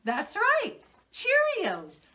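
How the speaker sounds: put-on high, loud cheery answer for the kids; excited reveal. Shown as a demonstration. Teacher revealing the answer to children, bright and excited. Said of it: "Can you help me out?".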